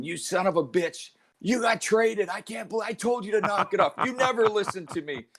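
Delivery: high, strained yelling in a put-on voice; furious coach rant. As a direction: rant at the player like an enraged coach. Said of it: "he was so pissed off at me".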